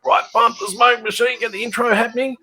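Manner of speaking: high-pitched, eager stage command; excited order. Retold or imitated. Band member giving the smoke machine guy orders, excited. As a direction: call out orders before the show.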